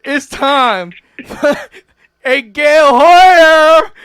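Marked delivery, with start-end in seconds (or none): 2.2-3.9 loud, slow drawn-out call of her name; drawn-out shout. Real, not acted. Calling out Gail's name to kick off the roast, drawn-out shout. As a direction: stretch the name out like an announcer.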